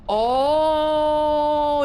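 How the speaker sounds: gasp, then let the realisation swell; surprised realisation. Real, not acted. Sudden realisation at the gate.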